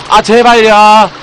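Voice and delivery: loud, drawn-out high begging; pleading whine. Real, not acted. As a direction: beg the viewers, stretching it out slowly. Begging viewers to let him play.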